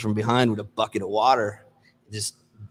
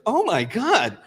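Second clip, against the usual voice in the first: much higher, shocked cry of the lady. Retold or imitated. Lady at the door sees the soaked salesman, much higher shock. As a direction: gasp it out high, horrified.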